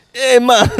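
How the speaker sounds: high, loud mock Jamaican accent; sudden Jamaican accent call. Switching accents mid-riff, mock Jamaican accent. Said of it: "Well, you did Jamaican pretty good".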